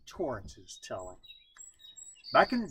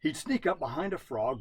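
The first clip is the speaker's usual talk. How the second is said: usual speaking voice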